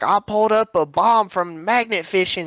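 mocking country magnet fisher, pitched high; imitated country guy reporting his big find; country accent. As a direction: put on a hammed-up country accent. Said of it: "didn't sound like that".

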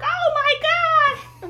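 high, drawn-out mock scream of a panicked crowd; imitated scream. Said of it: "they screaming".

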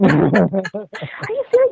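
starstruck, can't believe it; excited disbelief. Retold or imitated. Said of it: "Yeah, calm down".

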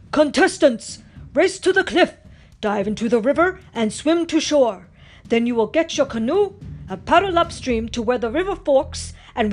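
loud chief calling out the course; booming instructions. A narrator's reading. Chief describing the race course to racers, loud. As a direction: project to the crowd of racers.

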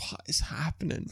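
hushed, whispered self-questioning; whispered disbelief. Retold or imitated. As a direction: whisper it as a panicked private thought. Retold private thought while nervous.

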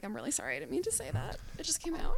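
mock apology, soft and high; apologetic letter. Imagined second envelope after her insult letter, softly acted apology. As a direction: squeak out a small, sheepish apology. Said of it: "another envelope that was like".

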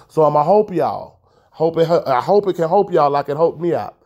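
"hope" and "hoped" with long o for help and helped; auntie's dialect "hope". Retold or imitated. Promising listeners help in auntie's dialect, "hope" for help. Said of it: "My auntie say hope".

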